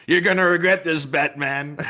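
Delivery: high, loud comic-book villain voice; hammy villain warning the hero; super villain threat. Retold or imitated. Villain taunting Batman in a hammed-up voice. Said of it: "like, super villains speaking to Batman".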